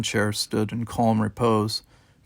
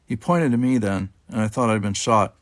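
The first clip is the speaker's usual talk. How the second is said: ordinary delivery, nothing marked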